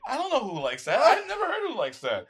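loud, high-pitched incredulous objection; retold indignant protest. Customer arguing with the bartender over pickles, indignant.

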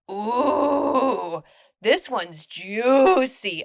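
slow, savoured ooh of gossip; relishing. Real, not acted. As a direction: drag it out, delighted by drama.

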